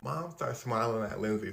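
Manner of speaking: usual speaking voice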